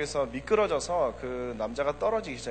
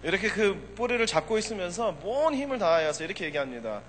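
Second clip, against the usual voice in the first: ordinary talk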